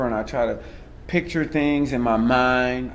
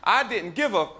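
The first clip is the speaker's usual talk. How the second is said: higher-pitched, projected stage storytelling; stand-up performance; 11 semitones higher than usual. Acted. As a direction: work the crowd with a mock confession.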